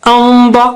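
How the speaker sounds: انبا with a thick, full nasal hum; slow, sustained full-mouthed hum in انبا; heavy ghunna. Shown as a demonstration. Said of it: "نون ساکنہ بھی بہت موٹا اونچا ہو گیا".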